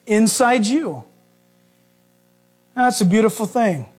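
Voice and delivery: normal talking voice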